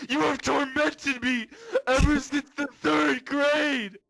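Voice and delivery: put-on high, slow wailing shout of a bullied kid; hammed-up crying rant. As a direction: rant at the bullies through mock tears. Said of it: "goes into this rant where he's like".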